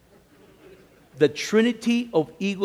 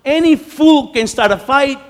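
booming proclamation; 8 semitones higher, 10 dB louder than usual. Acted. Preacher to congregation, a loud booming proclamation. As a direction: boom it out loud and high.

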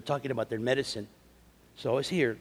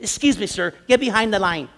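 imitating a loud, high, officious order; mock scolding, pitched up and loud; nasty clerk; 7 semitones higher, 9 dB louder than usual. Cha-cha girl ordering the preacher back in line, nasty. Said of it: "is talking trash to him".

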